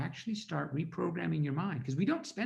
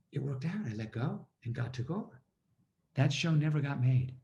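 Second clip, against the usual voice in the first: ordinary talk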